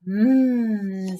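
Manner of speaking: mmm of relish while eating; happy mmm. A narrator's reading. Girl tasting the beans, delighted mmm. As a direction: savour the beans. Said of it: "said Camilla".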